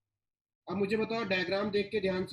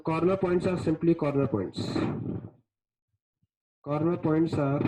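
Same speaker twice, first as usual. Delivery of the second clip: slow dictation; 3 semitones lower, 6 dB louder, much slower than usual. Acted. Teacher dictates definition slowly.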